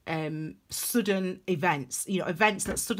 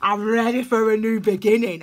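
loud, pumped-up acted cheer; eager hype; 7 dB louder than usual. Tarot reader replays welcoming a new beginning, loud and fired-up. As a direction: belt it out, raring to go. Acts out own eager reaction to crisis.

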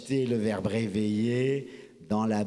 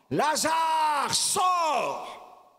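a high, ringing shout; loud voice; 3 dB louder, much slower than usual. Acted. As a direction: call the dead man out of the tomb. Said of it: "il dit d'une voix forte". (Jésus appelle Lazare hors du tombeau, d'une voix forte et haute)

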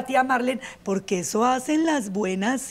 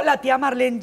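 ordinary delivery

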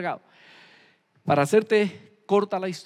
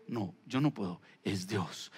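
humble reply; 11 semitones lower, 9 dB quieter, slower than usual. Retold or imitated. José's humble answer voiced.

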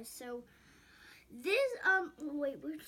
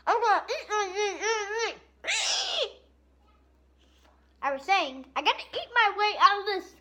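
hungry monster voice; 9 semitones higher, 13 dB louder than usual. Pretend. Eating monster voice.

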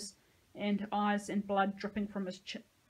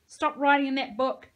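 loud, higher-pitched yell; yelled order; 5 semitones higher, 10 dB louder than usual. A narrator's reading. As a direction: yell it as a sharp command. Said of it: "he yelled".

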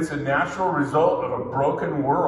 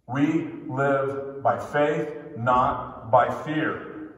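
slow, deliberate repeat; slowed-down preaching restatement; slow emphasis; 2 semitones lower, much slower than usual. Shown as a demonstration. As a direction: spell it out slowly. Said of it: "repeated slowly for emphasis".

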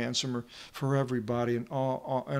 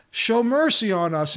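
higher, louder urgent plea; yelling for mercy; 7 semitones higher, 6 dB louder than usual. Retold or imitated. Blind men keep shouting to Jesus, loud urgent plea. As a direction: demand mercy at full voice. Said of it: "they're yelling it".

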